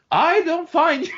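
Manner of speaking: very high, slow squeaky voice; mock squeaky voice. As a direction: squeak it out slowly. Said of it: "and I'm like".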